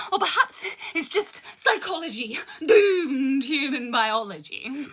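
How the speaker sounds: rhythmic poetry recital with dark punchlines; spoken word poem. Acted. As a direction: perform it like a slam poem. Continues the poem.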